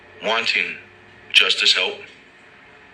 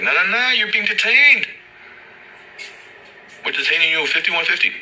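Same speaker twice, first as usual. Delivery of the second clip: lampoon the cop's fake concern, much higher; mock cop; 9 semitones higher than usual. Cop to the arrested man, mock caring police voice.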